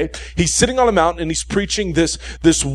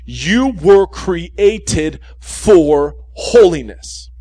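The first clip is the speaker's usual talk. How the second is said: very slow, louder, each word weighed; slow emphatic repeat; 6 dB louder, much slower than usual. Shown as a demonstration. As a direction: repeat it slowly and hammer it home. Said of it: "I'm gonna repeat this".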